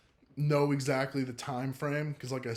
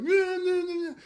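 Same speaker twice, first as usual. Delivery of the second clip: much higher, hammed-up sobbing wail; mock dramatic wailing; 6 dB louder than usual. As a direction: parody an overdone movie cry. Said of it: "the dramatic Hollywood crying".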